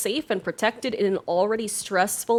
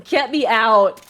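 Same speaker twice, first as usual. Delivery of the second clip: loud, slow, frightened; scared; 3 semitones higher, 5 dB louder, much slower than usual. Real, not acted. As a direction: say it shaken with fear. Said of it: "I am so scared".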